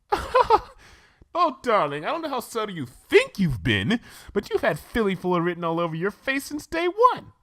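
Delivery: high gushing lady voice; Rarity voice. Acted. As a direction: gush like a fashionable lady. Rarity teasing Twilight.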